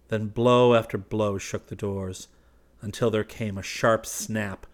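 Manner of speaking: plain reading voice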